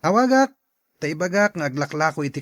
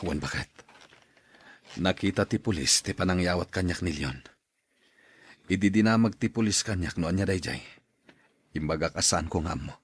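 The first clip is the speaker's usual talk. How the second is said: much lower, subdued put-on voice; low worried man; 12 semitones lower, 6 dB quieter than usual. Man telling his wife police questioned him, low and worried. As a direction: speak low and uneasy about the police.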